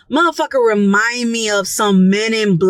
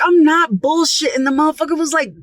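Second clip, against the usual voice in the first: ordinary talk, nothing marked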